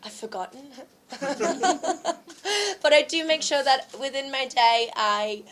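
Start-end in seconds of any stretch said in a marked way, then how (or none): 1.1-2.8 high burst of laughter; real giggly laugh; amused laugh. Real, not acted. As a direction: laugh at your own quip. Jokes she has forgotten.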